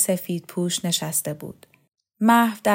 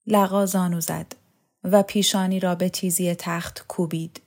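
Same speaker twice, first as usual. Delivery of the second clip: ordinary delivery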